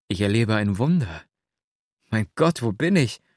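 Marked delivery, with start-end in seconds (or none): none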